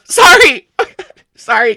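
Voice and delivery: high, loud blurted apology; comically upset outburst; tearful apology. Acted. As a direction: burst out, then choke it back. Breaking down, runs off crying.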